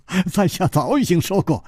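high merry laugh into speech. Acted. Zhang Xiaolin up on the eaves dodging a fight, laughing. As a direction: laugh heartily, then talk on brightly. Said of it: "笑道".

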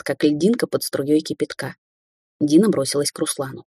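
ordinary delivery, nothing marked

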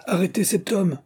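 high-pitched urgent cry; shouted order. A narrator's reading. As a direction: raise the alarm to a helper. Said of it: "cria-t-il à l'un des pêcheurs".

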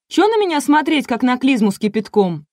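высокий возмущённый голос певицы; сердито огрызнуться высоким голосом. A narrator's reading. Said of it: "рассердилась Буба". (сердито, на высоких нотах)

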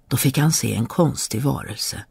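ordinary delivery, nothing marked

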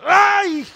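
high, loud cry of pain; yelled "ay". Retold or imitated. Acting out being struck by the preacher's words, loud yelp. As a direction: yelp as if hit by a stone. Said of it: "Hasta grito".

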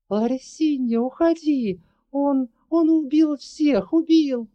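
high, panicked cry of warning; terrified shout. Acted. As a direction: scream it out in terror.

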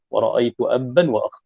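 usual speaking voice

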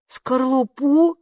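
«Скорлупу?» drawn out slowly with a rising doubtful tune; doubtful echo. A narrator's reading. Oscar doubts Kapo's eggshell guess, slow rising «Скорлупу?». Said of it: "с сомнением проговорил Оскар".

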